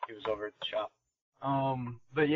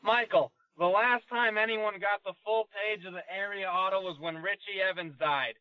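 put-on thick New England grandpa accent; 6 semitones higher than usual. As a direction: impersonate a proud old New Englander. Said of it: "a thick New England accent".

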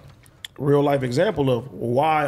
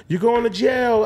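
high, drawn-out taunting call; teasing mock shout; 6 semitones higher than usual. Son kidding his mom about jail, mock shouting.

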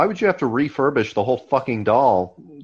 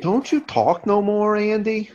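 much higher, sing-song coaxing voice; mock high therapist voice; 10 semitones higher, slower than usual. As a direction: coax Andy in a high mocking voice.

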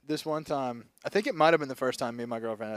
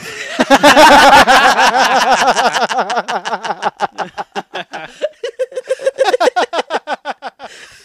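long howling laughter; loud laugh; 9 semitones higher, 13 dB louder than usual. Real, not acted. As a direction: completely lose it laughing. Loses it laughing.

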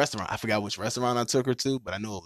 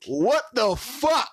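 high, drawn-out incredulous reaction; retold disbelief; 12 semitones higher, 4 dB louder, much slower than usual. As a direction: stretch it out slowly in mock shock. Retold shocked reaction to her apology.

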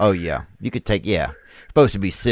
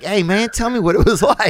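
higher, eager questioning; eager curious voice; 8 semitones higher than usual. Retold or imitated. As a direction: ask like you're dying to know. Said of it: "I'll be like".

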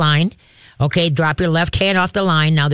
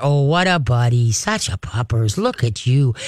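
low, soft, slower praise for the dog; 5 semitones lower than usual. Shown as a demonstration. Trainer crouching low, praising the dog softly and warmly. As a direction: coo to the dog as he arrives. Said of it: "And then start".

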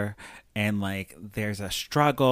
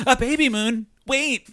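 excited realisation; 11 semitones higher, 6 dB louder than usual. Retold or imitated. Realising the killer's pattern.

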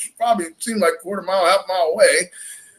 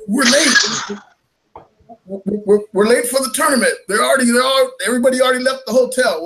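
retold yelling; 6 semitones higher than usual. Fisherman yelling at Denver to wake for the tournament, mock yell. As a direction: raise the voice to rouse the kid, hammed up a bit. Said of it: "Now I'm yelling at him".

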